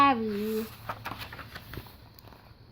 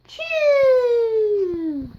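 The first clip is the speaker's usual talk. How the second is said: loud high explosive sneeze; loud comic sneeze ending on a high note; big sneeze; 8 semitones higher, 6 dB louder than usual. Acted. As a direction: let a huge sneeze burst out. Gerald sneezes.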